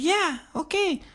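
very high, thin mimicry; reply as the old lady, high-pitched; old lady impression. Old lady agreeing to hear a question, high impression. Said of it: "She said, like".

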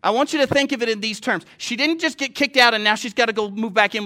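impassioned preacher at full voice; forceful preaching. Real, not acted. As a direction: drive the story home to the congregation.

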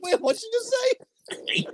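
high, slow disbelieving question; startled onlookers. Retold or imitated. Commentators hearing a wrestler's odd cry, baffled. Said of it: "And they're like".

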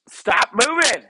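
sudden loud, high command; exasperated shout. Real, not acted. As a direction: snap at the dogs to keep still. Breaks off story to scold dogs.

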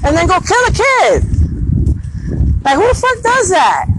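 real furious outrage; outraged. Real, not acted. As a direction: explode in disbelief. Outraged at child killing.

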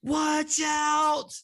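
high, drawn-out comic cry of alarm; mock warning shout. Blinking arrow warning the nervous pilot, high mock shout.

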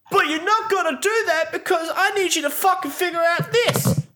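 high squeaky mock voice; mocking squeaky boss. Mocking the chief's rambling orders in a squeaky voice. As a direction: squeak it like a silly boss cutting himself off. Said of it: "it's just like".

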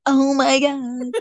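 slow, drawn-out mock groan; mock agony. Recalling a big knee crack, drawn-out mock agony. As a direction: drag it out as if a knee just cracked. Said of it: "then I'm like".

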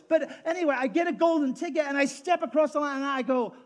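high, loud, passionate pulpit delivery; a pastor at full cry, loud and fervent; full-force preaching. Acted. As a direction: preach it like the crowd must hear every word.

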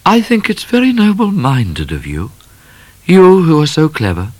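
high, light lady's voice musing to herself; half to herself, lightly voiced young lady; Miss Laura, musing aloud. A narrator's reading. Miss Laura musing to the cat, a lightly voiced lady. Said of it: "almost as if she was talking to herself".

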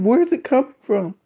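high, loud frightened exclamation; alarmed coworker. Retold or imitated. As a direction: act out her sudden shock, loud and high. Frightened coworker asks about the voice.

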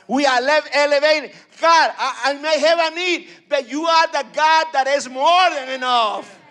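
high, loud preacher's cry building to a shout; preaching at full force. Acted. Preacher crying out praise to God before the church, loud.

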